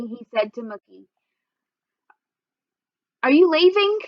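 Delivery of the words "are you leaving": put-on high child's voice. Little boy asks Mookie.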